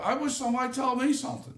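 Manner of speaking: ordinary talk, nothing marked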